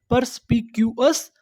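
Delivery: "Purse पी Q एस" in separate beats; broken into syllables. Shown as a demonstration. Teacher recalling the word for learners, syllable by syllable. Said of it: "टुकड़ों में तोड़-तोड़ के पढ़ना है".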